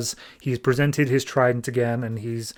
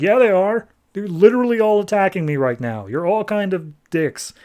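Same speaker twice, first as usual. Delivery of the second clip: exasperated king; 6 semitones higher than usual. Retold or imitated. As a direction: throw it back at him, irritated. Dead king snapping back.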